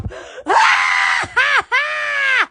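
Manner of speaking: long high cackle; high drawn-out laughing shriek. Real, not acted. Laughing after telling alarm story.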